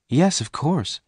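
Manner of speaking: high-pitched, lightly voiced woman; obliging woman. A narrator's reading. Jane answers the stranger.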